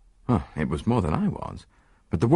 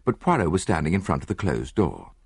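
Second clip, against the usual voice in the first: plain reading voice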